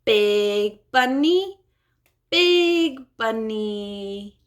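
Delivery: very slow "Big bunny" with each word stretched; slow modeling. Shown as a demonstration. Therapist modeling slowly for a child. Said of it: "So it might sound like this".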